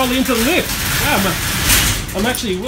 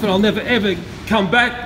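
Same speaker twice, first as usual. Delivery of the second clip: normal talking voice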